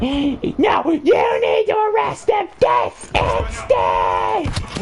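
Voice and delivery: very high, drawn-out outraged shriek; mock screeching demand. Lady orders cop to arrest kids, mock screeching demand. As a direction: shriek it like a hammed-up furious lady.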